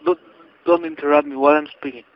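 ordinary delivery

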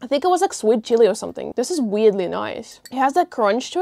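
talking between bites; words muffled by food; mouth full, chewing. Real, not acted. Seen 0: Bites jellyfish at -2.0, then speaks with lips tight, chewing.

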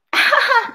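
short high mocking laugh; a quick snooty giggle; fish laughing. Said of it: "the rainbow fish just laughed".

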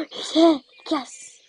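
thrilled burst as he finally arrives; excited gasp. Real, not acted. Horse arrives, she gasps.

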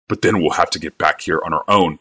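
ordinary delivery, nothing marked

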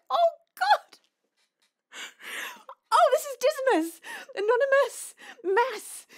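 high-pitched, loud squeal of recognition; 惊喜认出. Real, not acted. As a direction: burst out as the penny drops. Suddenly works out who donated.

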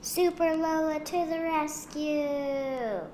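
high, slow, drawn-out call; long triumphant rallying cry; heroic battle cry. A narrator's reading. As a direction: cry out like a superhero leaping in. Hero battle cry in story.

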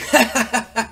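high cackle after a boast; cocky wrestler's laugh at his own line; gloating laugh. Acted.

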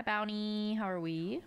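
ordinary talk, nothing marked

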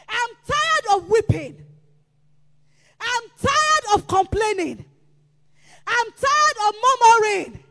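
loud, driving sermon cadence; preaching at full force. Acted. Pastor urging the congregation, preaching at full force. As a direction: hammer each line home to the church.

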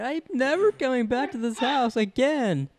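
high, slow mock vow; hammed-up huffy vow; imitating Junior. Friend mimics Junior refusing to return, high slow mock vow. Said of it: "And he's like".

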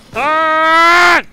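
high, drawn-out yell; furious shout. Real, not acted. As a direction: scream the word at the enemy. Enemy will not burn.

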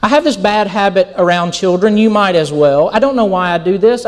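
ordinary talk

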